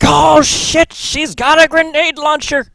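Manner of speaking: high, loud shout of alarm; screaming high and loud in terror; panicked yell. Retold or imitated. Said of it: "and everybody's like".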